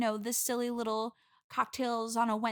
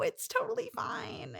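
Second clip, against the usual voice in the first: forced cheer; 12 semitones higher, 4 dB quieter than usual. Acted. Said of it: "that sounded like you're a little maybe jealous".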